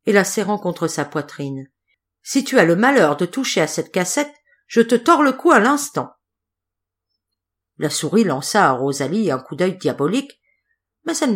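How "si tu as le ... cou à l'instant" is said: threat. A narrator's reading. Rosalie threatens the mouse.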